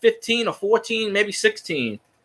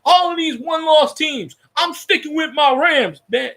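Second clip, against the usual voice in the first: higher put-on voice; pitched-up mocking pundit voice; mock boast; 5 semitones higher than usual. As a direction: parody his stubborn loyalty. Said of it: "He's like".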